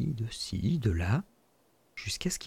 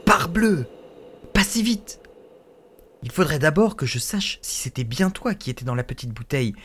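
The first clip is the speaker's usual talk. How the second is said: put-on clever boy; 3 semitones higher than usual. Schoolboy tricking the spirit, higher and quick. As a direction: stop him sharply, then bargain.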